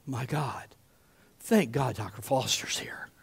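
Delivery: low, half-whispered thanks; hushed relief. Acted. Mom seeing the trusted doctor arrive, hushed relief. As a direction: let it out softly, almost whispering.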